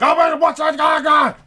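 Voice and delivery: high, loud panicked yelling; panicked freak-out. Acted. Dad mimics a panicking passenger during a driving lesson, hammed-up panicked shout. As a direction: shriek like a passenger flipping out. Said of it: "person in the passenger seat is flipping out".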